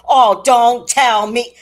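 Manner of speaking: loud, slow laughing mimic of the boy; mock grandson. Imitating grandson dismissing her, slow loud laugh. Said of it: "He said to me".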